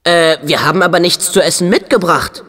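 usual speaking voice

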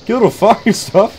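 exasperated demand. Real, not acted. Gamer demanding a stop a second time, openly exasperated. Repeats the demand more forcefully.

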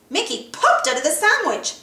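plain reading voice

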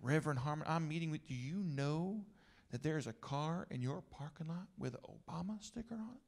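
quietly put-on Southern accent; rich Southern accent. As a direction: imitate a Virginian gentleman with a thick Southern accent. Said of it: "had a particularly rich Southern accent".

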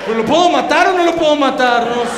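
mock confused believer. A believer forgets the commandments, high, loud and flustered. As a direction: whine it like a forgetful follower. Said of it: "porque después como".

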